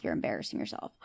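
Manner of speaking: whispered cold put-down. Retold or imitated. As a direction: hush it out with contempt. Cold retort quoted from Steven.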